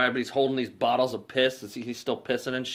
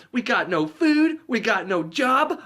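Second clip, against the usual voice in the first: much higher, louder, drawn-out complaint; desperate rant; 10 semitones higher, 5 dB louder, slower than usual. Retold or imitated. Harry to Lloyd about their ruin, hammed-up despairing wail. Harry rants, high and slow.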